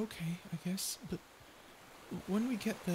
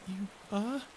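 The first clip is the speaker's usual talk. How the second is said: normal talking voice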